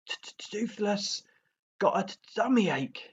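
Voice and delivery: high, stammering little dragon whine; stuttering dragon complaint. A narrator's reading. Toothless complains to Hiccup of a tummy ache, high stammer. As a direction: stammer it out like a sulky small dragon. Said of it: "he complained".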